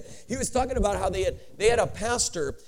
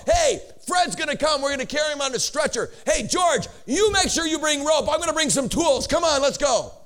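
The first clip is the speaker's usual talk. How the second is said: rallying phone call; 6 semitones higher, 4 dB louder than usual. Acted. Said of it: "Can you imagine the phone call?".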